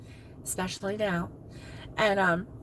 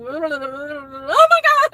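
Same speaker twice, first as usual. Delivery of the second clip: despairing groan; 3 semitones higher, 10 dB louder, much slower than usual. Retold or imitated. Retelling the worst day of teaching, a slow despairing moan. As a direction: drag it out, at the end of your rope. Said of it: "I was like".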